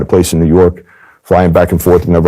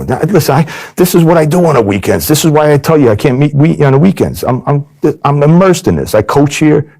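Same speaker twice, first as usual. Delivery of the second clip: much higher, rising indignant rant; exasperated scolding; 10 semitones higher than usual. Retold or imitated. Agent turning the tables on the mobster: high indignant rant. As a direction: pile on the guilt like a nagging friend.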